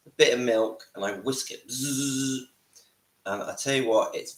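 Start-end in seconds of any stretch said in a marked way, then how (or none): 1.7-2.5 imitated whirring buzz; blender buzz. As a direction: buzz like a little whisk.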